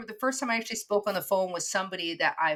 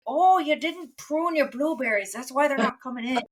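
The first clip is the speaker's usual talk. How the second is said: farmer's advice; 7 semitones higher, 4 dB louder than usual. Retold or imitated. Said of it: "I get to go to an actual farmer and be like".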